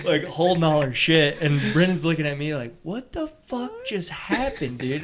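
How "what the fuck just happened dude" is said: high, hushed, slow incredulity; mock stunned disbelief. Storyteller as the stunned guide after client vanished, mock disbelief. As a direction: draw it out softly in a high, baffled voice. Imitating Brennan's stunned look and words.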